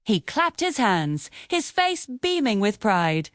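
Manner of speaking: ordinary delivery, nothing marked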